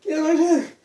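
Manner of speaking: very high, loud excited outburst; high-pitched, fired-up holler of alarm; shocked excitement. Retold or imitated. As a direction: shriek it in disbelief and excitement. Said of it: "in shock, uh, disbelief, uh, excitement".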